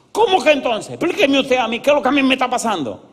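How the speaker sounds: high, loud, exasperated mock demand; furious yelling, retold. Agitated visitor demands the pastor explain his feelings, imitated angry shout. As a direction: imitate a man shouting for answers. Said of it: "me gritaba y me decía".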